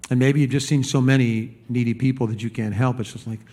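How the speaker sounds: normal talking voice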